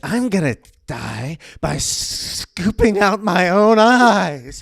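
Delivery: high, slow, strained laughing delivery; theatrical voice. Pretend. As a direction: ham up a gruesome vow. S1 performs morbid dramatic line.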